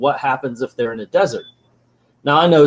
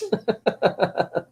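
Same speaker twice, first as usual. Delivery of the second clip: high, softer run of giggles; amused laugh; 7 semitones higher, 5 dB quieter than usual. Real, not acted. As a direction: giggle helplessly at the absurdity. Laughs at the absurd power.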